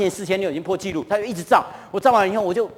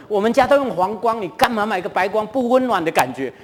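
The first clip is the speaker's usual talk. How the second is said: mock scolding wife; 3 semitones higher than usual. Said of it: "我太太就一直骂我说".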